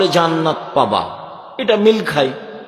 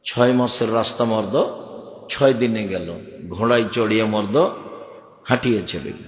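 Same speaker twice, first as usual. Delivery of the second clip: lower, understated verse recitation; low puthi recital; 4 semitones lower, 4 dB quieter than usual. Shown as a demonstration. Preacher repeats the puthi verse to compare it with sermons, low recital. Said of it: "এই পুঁথির বইয়ের কথা একই".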